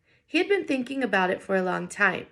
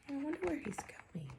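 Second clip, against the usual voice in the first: much softer, hushed wondering; wondering aside; 7 semitones higher, 14 dB quieter than usual. Acted. Teacher musing to the class, hushed. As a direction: lean in and wonder quietly.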